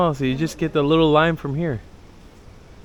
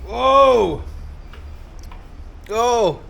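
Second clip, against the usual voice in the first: surprised whoa; 11 semitones higher than usual. Real, not acted. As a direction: let the sourness hit you. Tasting very sour lime juice.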